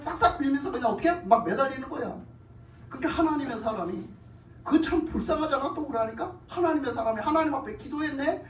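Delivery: ordinary talk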